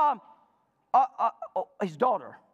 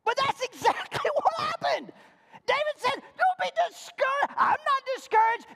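preaching shout. Real, not acted. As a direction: shout it to the rafters. Preacher shouting at full force.

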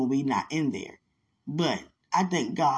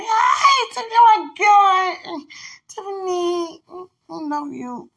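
much higher, louder cry of joy; overjoyed shout; 13 semitones higher, 9 dB louder, slower than usual. Retold or imitated. Finally spotting Tiffany in the store, overjoyed shout. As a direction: scream her name with delight.

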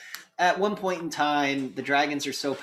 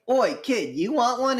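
high, loud "Oi, kid" in a hammed-up British accent; British miner calling to a kid; mock British miner; 11 semitones higher, 6 dB louder than usual. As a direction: play the gruff British miner offering a smoke. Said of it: "and he goes".

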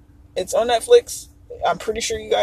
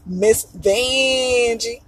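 drawn-out name; much slower than usual. Shown as a demonstration. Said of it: "but Vanjie".

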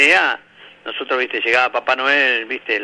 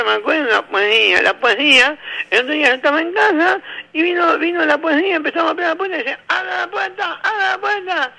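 put-on nasal kid voice; 14 semitones higher than usual. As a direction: ham up the nasal kid recounting. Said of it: "el nene gangoso".

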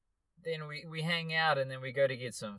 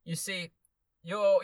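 put-on Zimbabwean accent; 6 semitones higher, 4 dB louder, slower than usual. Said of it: "the Zimbabwe guy".

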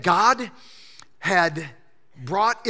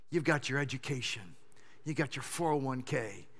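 quiet pastoral preaching; 8 semitones lower, 10 dB quieter than usual. Acted. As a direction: draw them in close and gently. Quiet, intimate pastoral appeal.

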